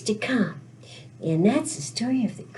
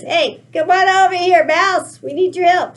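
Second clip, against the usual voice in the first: loud, raised calling voice; 5 semitones higher, 6 dB louder than usual. Acted. Kitty cat calling the mouse over to help, loud call. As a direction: shout the mouse over, higher and urgent. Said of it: "kitty cat says".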